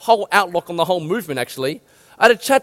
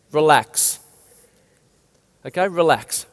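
calm relax; 6 semitones lower than usual. Shown as a demonstration. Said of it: "write this word down".

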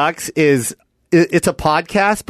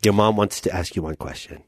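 low-voiced dad; father; 9 semitones lower, 5 dB quieter than usual. Dad praising the movie's cast, in a low voice. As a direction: drop into a deep father voice. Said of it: "I'm gonna play your dad".

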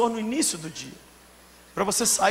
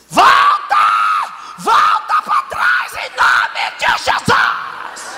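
hysterical mock shouting; 3 semitones lower, 10 dB louder, much slower than usual. Overwrought woman ordering her tears back in Jesus' name, screaming. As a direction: holler it like a frantic, over-the-top woman. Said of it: "a mulher doidona, escandalosa".

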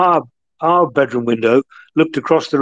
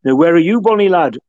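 put-on higher-pitched friend's northern English accent; 5 semitones higher, 4 dB louder than usual. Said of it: "in his northern accent".

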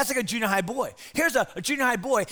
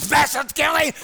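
put-on kid calling out; 5 semitones higher, 6 dB louder than usual. Bobby calling out in restroom.

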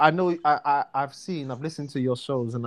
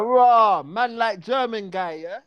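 cheerful slang term of endearment; London greeting; 7 semitones higher than usual. Shown as a demonstration. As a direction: greet a mate with swagger. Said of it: "I'll be like".

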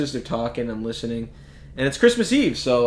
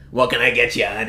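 diner waitress; 4 dB louder than usual. Retold or imitated. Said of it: "classic fifty to sixty-year-old diner waitresses".

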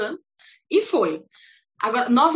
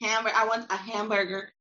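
American accent; 3 semitones lower, 4 dB quieter than usual. Shown as a demonstration. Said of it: "tipo, hamburger".

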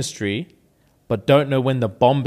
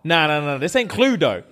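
ordinary delivery

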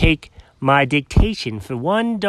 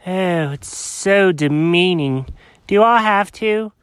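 ordinary delivery, nothing marked

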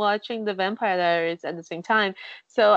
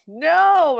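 loud, higher mock cry of horror; imitated scream; 5 semitones higher, 8 dB louder than usual. Viewer imitating Carlisle's scream in the film, mock yell. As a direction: act out a film scream at moderate force.

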